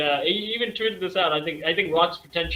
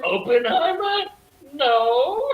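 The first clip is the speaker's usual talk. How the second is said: higher, mock-incredulous question; 7 semitones higher, slower than usual. Real, not acted. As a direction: scoff at the idea. Mocking the best-movie claim.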